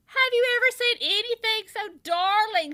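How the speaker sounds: wife cooing over the piglet; gushing. A narrator's reading. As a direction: gush over a baby.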